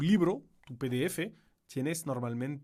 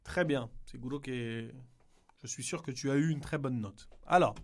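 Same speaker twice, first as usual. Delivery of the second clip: ordinary talk, nothing marked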